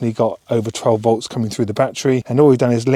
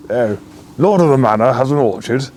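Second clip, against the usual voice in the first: posh lord voice; 4 dB louder than usual. Pretend. Mock posh self-mockery.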